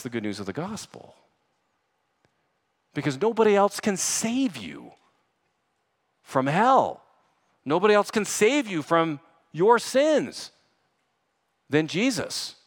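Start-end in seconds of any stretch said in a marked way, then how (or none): 2.9-12.5 high, pleading pulpit cry; impassioned preaching. Real, not acted. Preacher urging sinners to run to Jesus, impassioned. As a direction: plead with them at full force.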